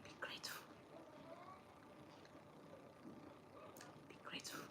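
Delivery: whispered admonition. Shown as a demonstration. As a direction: whisper it firmly. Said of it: "repeated whispered admonition".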